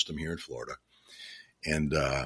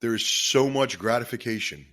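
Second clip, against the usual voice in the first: fighting back tears to keep talking to listeners; choked up with emotion; 3 semitones higher than usual. Real, not acted. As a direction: voice catching, long pauses between phrases. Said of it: "I get pretty emotional about this stuff".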